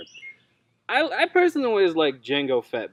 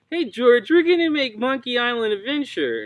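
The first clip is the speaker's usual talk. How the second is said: high, loud mock exec pitch; mock pitch to George; 9 semitones higher, 4 dB louder than usual. Studio exec pitching to George, high and eager.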